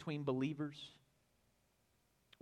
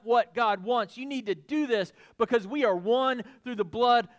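louder, higher, pressing every clause; preaching at full force; 6 semitones higher, 7 dB louder than usual. Acted. Preacher urging Philemon to act for love's sake, full force. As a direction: drive the appeal home to the congregation.

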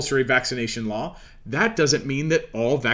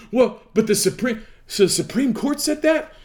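rising far higher in disbelief; a startled, much higher question; taken aback; 6 semitones higher than usual. Acted. Acted citizen taken aback by reply.

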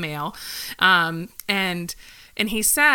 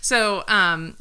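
ordinary talk

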